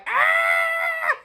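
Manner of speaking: very high imitated engine shriek; engine scream. Mimicking his screaming engine, a very high shriek. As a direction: shriek like the screaming small block. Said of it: "that thing was like".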